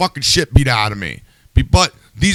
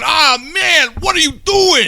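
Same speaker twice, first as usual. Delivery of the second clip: much higher, exasperated cry; retold groan; 12 semitones higher than usual. Host retells watching the speech, exasperated high cry. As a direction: groan at the screen in dismay. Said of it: "without going".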